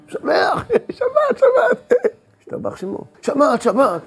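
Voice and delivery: very high, laughing cries; laughing with joy. Acted. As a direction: laugh out the words in rapture. Overflowing Shabbat joy.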